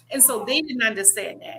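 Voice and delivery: normal talking voice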